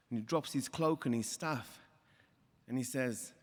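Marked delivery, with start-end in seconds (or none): none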